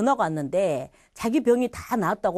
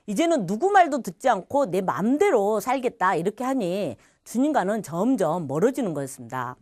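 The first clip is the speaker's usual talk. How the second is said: ordinary talk, nothing marked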